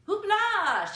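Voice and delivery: high, loud circus cry; excited cry. A narrator's reading. La Gloria crying out to the audience, high and loud. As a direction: fling it out to the crowd. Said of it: "she cried".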